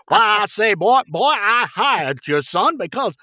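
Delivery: loud, high blustering rooster drawl; Foghorn Leghorn. Acted. Actor voicing the business father as director asked, Foghorn Leghorn bluster. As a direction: boom it out like a pompous cartoon rooster. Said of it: "a little foghorn leghorn".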